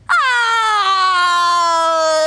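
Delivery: a gasp then hammed-up crying; mock cry. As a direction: fake a big dramatic sob. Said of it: "Now I have to cry".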